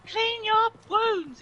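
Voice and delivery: high, loud and slow nagging call; imitating the friend. Friend on the mic nagging the brother, high slow loud call. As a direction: drag it out like a fed-up mate. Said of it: "his friend on the mic going".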